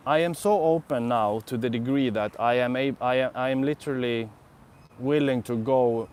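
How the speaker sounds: normal talking voice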